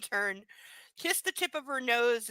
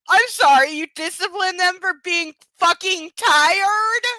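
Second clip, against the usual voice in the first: real incredulous yell, higher and louder; outraged; 8 semitones higher, 13 dB louder, slower than usual. Real, not acted. Reader blasts the hero for punishing tiredness, shouting. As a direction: yell in disbelief, slowing down.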